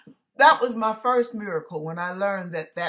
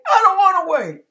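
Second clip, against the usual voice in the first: much higher, louder cry of protest; 11 semitones higher, 13 dB louder than usual. Retold or imitated. Arguing back at the radio hymn, loud and high protest. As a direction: plead loudly against waiting.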